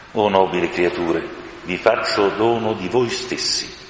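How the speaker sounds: plain reading voice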